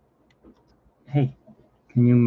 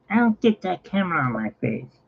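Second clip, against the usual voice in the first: mock diva; 8 semitones higher than usual. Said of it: "He's like women".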